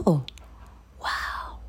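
whispered wow of wonder; a hushed, amazed wow; awed wow. Shown as a demonstration. Showing viewers how she marvels, whispered wow. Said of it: "empezaba con este guau, así".

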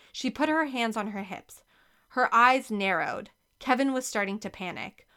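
usual speaking voice

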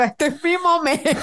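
gleeful line through laughter; laughing. Retold or imitated. Finally her moment to tease in-laws, laughing. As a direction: giggle out your triumph. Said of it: "yo no aguantaba la risa".

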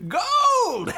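put-on an excited old prospector yelling; grizzled prospector. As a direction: shout it high like an old gold miner. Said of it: "talk like a grizzled eighteen hundreds prospector".

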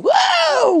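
high loud whoa yell; mock whoa yell. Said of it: "neighbors complained about you going".

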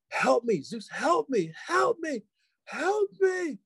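mock cries climbing to a high wail; imitated screams. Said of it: "the screams, just, I cannot even".

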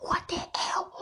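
hushed, disbelieving aside; stunned whisper. Retold or imitated. Recalling her shock at the date's plans, whispered. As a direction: whisper it as if not to be overheard. Reacting to date's creepy remark.

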